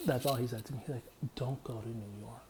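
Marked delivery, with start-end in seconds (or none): 1.4-2.4 a low, hushed voice for the uncle; low and hushed, a lightly voiced uncle; uncle's low warning. Uncle warning the speaker away, low and hushed.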